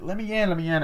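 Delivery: high, urgent mock plea; urgent pleading. Girl trying to get in, urgent high plea. Begging to be let inside.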